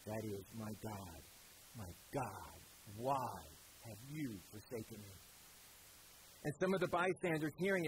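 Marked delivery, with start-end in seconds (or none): 0.0-5.1 hushed cry. Acted. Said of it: "cried out with a loud voice".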